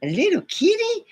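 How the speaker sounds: high, squealing over a kitten; retold squeal of delight at the offer of a kitten. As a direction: coo at the idea of a kitten. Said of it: "I go".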